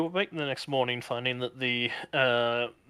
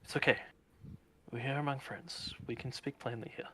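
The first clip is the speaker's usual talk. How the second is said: soft, soothing reassurance, fading quieter; calm a frightened friend; gentle cooing; 7 dB quieter than usual. Acted. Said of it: "almost cooing slightly".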